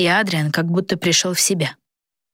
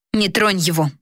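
snapped. A narrator's reading. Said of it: "рявкнула принцесса".

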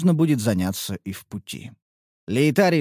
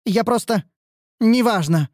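flustered; 7 semitones higher than usual. Acted. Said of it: "вспыхнула брюнетка".

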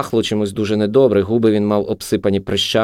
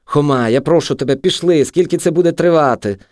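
raised, urgent plea; worried, higher-pitched entreaty; frightened pleading; 6 semitones higher, 3 dB louder than usual. A narrator's reading. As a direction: beg him anxiously to leave. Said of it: "Марта не на жарт боялася".

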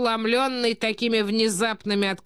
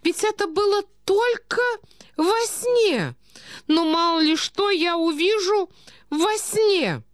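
put-on very high, protesting old prince; befuddled old prince; 11 semitones higher, 3 dB louder, slower than usual. A narrator's reading. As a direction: plead helplessly in a high old man's voice. (старый князь, высоко и жалобно протестует)

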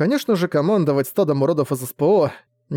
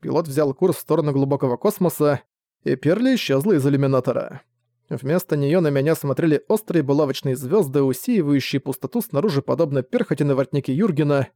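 usual speaking voice

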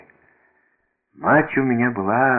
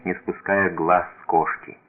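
ordinary delivery

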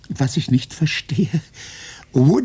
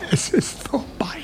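despairing whisper; 4 semitones higher, 5 dB quieter than usual. Acted.

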